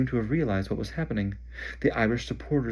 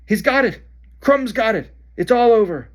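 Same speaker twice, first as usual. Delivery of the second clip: high, loud triumphant shout; excited shout; 12 semitones higher, 8 dB louder than usual. Acted. Harry shouting to everyone that it is over, triumphant. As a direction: call out the finish. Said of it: "shouted Harry".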